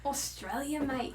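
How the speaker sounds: put-on lightly echoed Aussie accent; Australian accent. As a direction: try an Australian twang on it. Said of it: "I love Australian accents".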